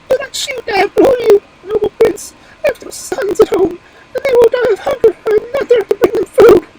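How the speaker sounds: high, loud squeaky bird voice; very high-pitched, loud imploring pigeon; pleading wood pigeon. Acted. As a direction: beg for your life as a tiny frantic bird.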